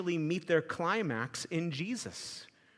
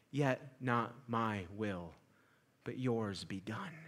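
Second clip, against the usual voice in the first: much lower, soft and slow; quiet surrender; 11 semitones lower, 11 dB quieter, slower than usual. Acted. Jesus surrendering to the Father, soft and slow. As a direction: yield gently, barely above a breath.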